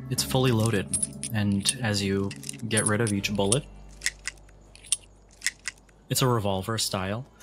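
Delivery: ordinary talk, nothing marked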